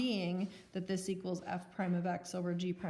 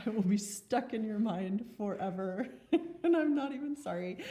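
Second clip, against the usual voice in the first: laughing; 4 dB louder than usual. Real, not acted. Teasing about dorky mnemonic.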